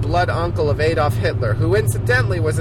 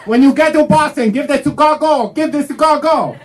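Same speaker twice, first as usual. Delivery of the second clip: pushy bus worker; 8 semitones higher, 6 dB louder than usual. Retold or imitated. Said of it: "bus worker hands over crate".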